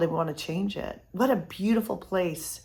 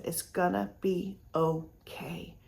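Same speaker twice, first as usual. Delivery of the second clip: soft and slow, almost hushed; gentle reassurance; 7 dB quieter, slower than usual. Retold or imitated. Comforting a sobbing stranger in the airport line, soft and slow. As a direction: ease her pain with slow soft words.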